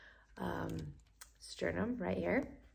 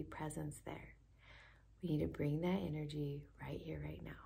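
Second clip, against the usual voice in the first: soft, hushed, quick meditation whisper; guided whisper; 6 dB quieter than usual. Acted. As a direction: lean in and whisper the secret.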